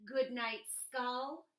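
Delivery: normal talking voice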